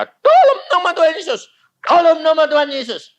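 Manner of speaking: loud, high-pitched prayer call; commanding prayer. Retold or imitated. Pastor praying over the dead child, loud commanding prayer. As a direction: proclaim it with authority.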